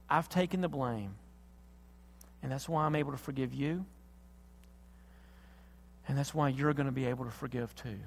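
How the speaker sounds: hushed, solemn confession of love; tender reassurance. Acted. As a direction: speak quietly, as God consoling the hurt. God's tender answer in acted dialogue.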